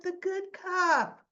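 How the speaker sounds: mock sweet teasing; teasing answer. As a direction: draw it out teasingly to Jason. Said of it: "She said".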